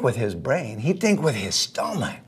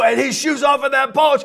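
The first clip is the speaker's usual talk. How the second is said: usual speaking voice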